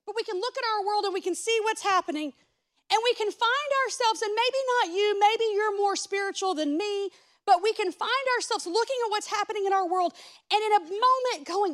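loud, high, fervent preaching to the congregation; preaching at full force. Acted. Preacher urging his congregation, full-force preaching. Preaching about questioning God.

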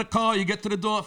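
ordinary delivery

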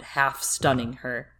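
ordinary talk, nothing marked

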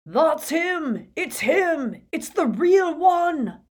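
high, loud triumphant yell; Death Eater's shout. Acted. Death Eater recognising Harry, loud triumphant shout. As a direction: scream it out in recognition. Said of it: "The hooded Death Eater's shout".

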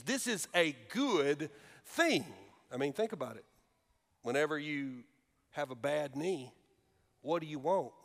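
normal talking voice

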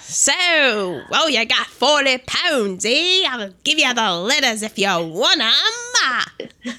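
broad mock Cockney with swooping tune; bad Cockney. Mock seller offering letters for forty pounds, bad Cockney. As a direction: haggle in a hammed-up Cockney. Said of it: "doing a Cockney accent".

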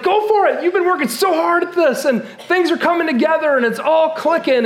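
wife urging him, high and eager; encouraging wife. Retold or imitated. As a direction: cheer him on in a high bright voice. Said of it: "And Juanita's like".

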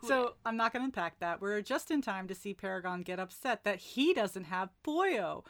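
ordinary delivery

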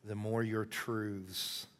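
soft, halting spoken prayer. Real, not acted. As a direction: pray quietly with long pauses. Leads a closing prayer.

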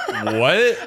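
puzzled high what; confused. Pretend. Confused guests in sketch.

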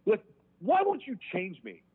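high, pleading angry demands; pissed off. Retold or imitated. Desperate inmate demanding that God heal him, pissed off. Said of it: "I get pissed off. And I'm like".

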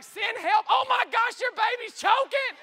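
high, loud hammed-up hysteria; mock screaming. Emergency reaction team answering the call, mock screaming. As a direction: shriek it like a useless panicking operator. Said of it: "everybody screaming".

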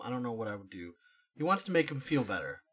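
ordinary talk, nothing marked

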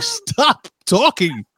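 high, drawn-out mock pleading; retold exasperation. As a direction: act out begging for silence, stretching each word. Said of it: "I'm like".

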